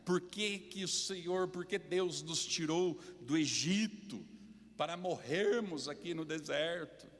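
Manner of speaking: queixa em voz alta e aguda; reclamação indignada. Acted. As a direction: reclame como um povo revoltado no deserto. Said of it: "começa a reclamar". (reclamando, indignado)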